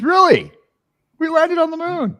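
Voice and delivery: high, loud thrilled gasp; excited movie quote. Retold or imitated. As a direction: act thrilled at the news, high. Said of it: "he looks at the thing and says".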